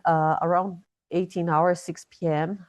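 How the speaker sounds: ordinary delivery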